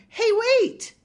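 very high, loud yell; panicked shout. Acted. Burglar protests and flees.

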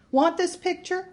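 high sharp offer; high mocking offer. A narrator's reading. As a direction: push it on him, high. Marge offering photo.

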